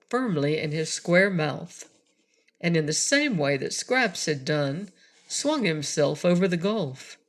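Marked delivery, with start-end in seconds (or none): none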